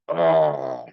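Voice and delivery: loud, low, drawn-out groan of frustration; exasperated groan. Acted. Man recalling a betrayal, exasperated groan. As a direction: groan out the pent-up resentment. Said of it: "man, I just get".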